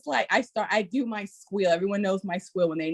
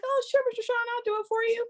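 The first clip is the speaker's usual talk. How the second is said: eager parent; much faster than usual. Retold or imitated.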